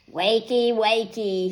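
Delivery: a touch of a mocking snarl; wolf waking Sleeping Beauty. A narrator's reading. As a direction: snarl the wake-up call. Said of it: "he snarled".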